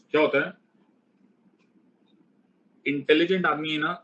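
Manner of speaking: ordinary talk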